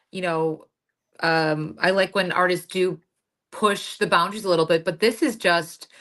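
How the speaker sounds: ordinary talk, nothing marked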